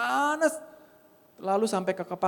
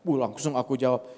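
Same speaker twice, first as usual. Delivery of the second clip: ordinary talk, nothing marked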